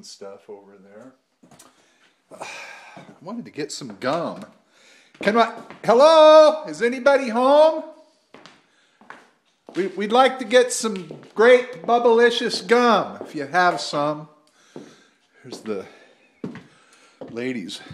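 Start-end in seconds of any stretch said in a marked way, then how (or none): 5.2-14.3 loud, high call into the empty store; calling down a hallway. Real, not acted. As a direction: shout out to find someone in back.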